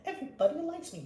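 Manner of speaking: high, fast sneering mimic with a laugh; mock their self-satisfied tone in a high voice; high mocking quote; mocking smug voice. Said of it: "think that you're holier than thou".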